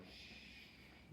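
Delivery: unvoiced hushing shh; gentle breathy shh; soothing shh. Shown as a demonstration. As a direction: shush like calming a crying child. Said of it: "that way of going".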